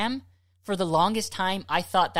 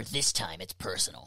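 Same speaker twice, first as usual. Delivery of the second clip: trailer voice; 10 semitones lower, 8 dB quieter than usual. Retold or imitated. Quoting movie tagline.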